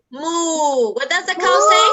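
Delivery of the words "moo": long drawn-out moo; cow moo. Shown as a demonstration. Teacher showing children the cow's sound, long moo. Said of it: "vamos haciendo sonidos".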